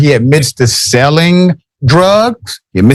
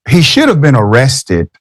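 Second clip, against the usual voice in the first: normal talking voice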